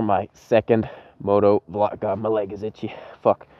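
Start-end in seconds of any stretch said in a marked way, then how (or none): none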